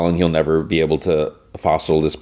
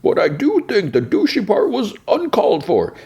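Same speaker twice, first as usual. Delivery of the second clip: high, slow put-on voice for the big guy; a mild, reasonable Bane done way up high; silly high-pitched imitation of Bane. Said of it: "And Bain's, like, sitting there".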